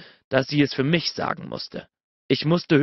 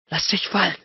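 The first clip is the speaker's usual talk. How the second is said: higher, mildly desperate urging; shaky with desperation; 5 semitones higher than usual. A narrator's reading. Narrator urging his lover to let go, desperate. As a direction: urge it with a touch of desperation. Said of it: "Meine Stimme war zittrig vor Verzweiflung".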